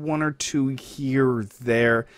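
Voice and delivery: normal talking voice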